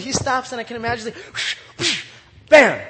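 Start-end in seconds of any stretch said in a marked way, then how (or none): 1.1-2.0 breathy sling whoosh; whispery whoosh sound effect; imitated whoosh. 2.5-2.8 sudden loud burst; short, booming sound effect; explosive impact. Acted. Imitates the stone striking.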